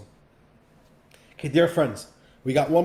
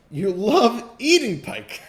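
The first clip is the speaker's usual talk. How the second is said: slow, loud, high "eating" stressed; emphatic; 7 semitones higher, 8 dB louder, slower than usual. Real, not acted. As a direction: lean hard on "eating". Mocking point of parable.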